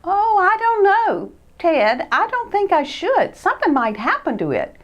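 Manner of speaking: high, uneasy little mouse voice; hesitant Christopher. Acted. Christopher unsure about leaving his new sled. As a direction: hold back, unsure.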